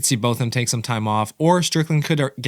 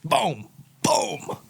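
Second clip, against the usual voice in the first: mock boom; 7 semitones higher than usual. Mimicking knockout punches.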